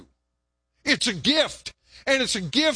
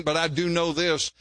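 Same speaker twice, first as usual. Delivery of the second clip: ordinary delivery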